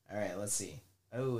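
usual speaking voice

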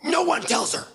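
very high, tense producer voice; frantic high-pitched warning, mock-serious; Gelman. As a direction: imitate a panicked order to everyone backstage. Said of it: "Gelman, who's the producer of the show".

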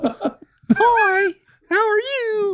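squeaky, very high mock greeting; silly high voice. Greeting friends in jest, silly high voice. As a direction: pipe up in a put-on squeak.

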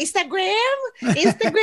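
high, baffled repeat of the word; mock the friends' confusion at the English word; puzzled friends. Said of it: "Aí as minhas amigas".

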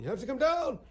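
high, loud frantic panic; frantic Larry. Retold or imitated. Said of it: "frantic, going".

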